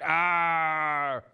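long held comic wail; hammed-up drawn-out "ah" of pain; mock scream. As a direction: sustain a pretend burning yell. Acting out the burning mascot.

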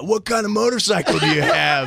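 lightly voiced tough biker; a hint of a gruff biker asking; tough guy voice. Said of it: "tough guy's like".

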